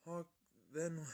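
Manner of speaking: ordinary delivery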